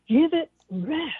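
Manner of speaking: usual speaking voice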